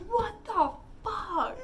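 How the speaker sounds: slow, high, shocked disbelief. Retold or imitated. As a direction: gasp it out in disbelief. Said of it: "We were like".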